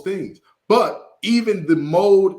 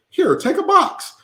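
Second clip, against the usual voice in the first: much higher, slow, sweet lady voice; imitating the woman; slower than usual. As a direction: play the generous hostess handing it over. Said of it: "And she said".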